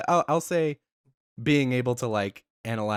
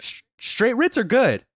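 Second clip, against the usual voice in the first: emphatic protest; 11 semitones higher, 7 dB louder than usual. Real, not acted. Heated cracker argument.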